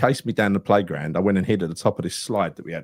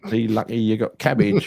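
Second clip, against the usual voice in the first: usual speaking voice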